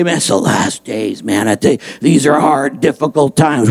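gloomy doomsayer. Retold or imitated. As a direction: sigh it out like a grumbling pessimist.

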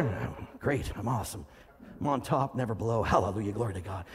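low, subdued mock church-speak; fake all-is-well reply. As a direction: rattle off praise softly in a dropped voice. Mimics fake-cheerful church reply.

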